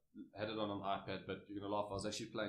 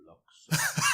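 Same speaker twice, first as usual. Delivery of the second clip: put-on a much higher, louder Afrikaans-accented blurt, laughing through it; Afrikaans exclamation; 10 dB louder than usual. Teammate hearing the auction price, blurting in Afrikaans accent. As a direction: burst out with Blocks the Afrikaans way, half laughing. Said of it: "in a very Afrikaans way".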